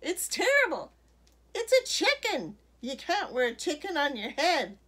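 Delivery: loud, high-pitched outraged bellow; scandalised, over-the-top scolding. A narrator's reading. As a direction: hammer each verdict out at full voice. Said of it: "bellowed Missus McSnoot".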